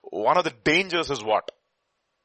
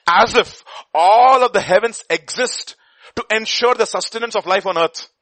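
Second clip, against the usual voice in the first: preaching; 8 semitones higher, 9 dB louder than usual. Acted. Preaching about cosmic fine tuning.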